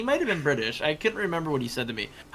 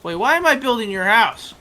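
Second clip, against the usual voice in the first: louder, higher fake outrage; mock indignant; 7 semitones higher, 9 dB louder than usual. Player pretends to be outraged building friend's house. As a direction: play at being offended.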